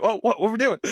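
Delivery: high, startled and confused, questioning what's happening. Retold or imitated. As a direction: sound lost at the table, as if you just walked back in. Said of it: "I'm like".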